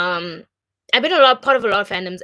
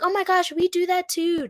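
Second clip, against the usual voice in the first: high, excited imitation; high-pitched retold amazement; delighted recognition; 11 semitones higher than usual. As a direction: imitate someone thrilled to find common ground. Retold delighted reaction to shared culture.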